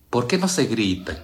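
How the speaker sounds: normal talking voice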